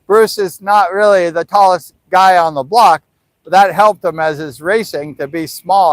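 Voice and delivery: usual speaking voice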